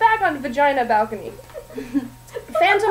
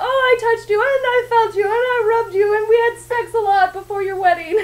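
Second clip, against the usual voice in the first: higher, louder swooning lovers' mock serenade; mock romantic duet; 9 semitones higher, 5 dB louder than usual. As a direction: ham up a gushing love song. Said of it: "they sing this beautiful song about".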